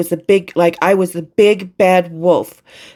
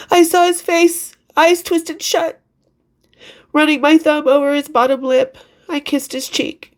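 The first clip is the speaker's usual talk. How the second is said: choked up; 7 semitones higher than usual. Real, not acted. Author reads of kissing her dead friend, choked up. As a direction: hold back tears while reading, voice pitched up.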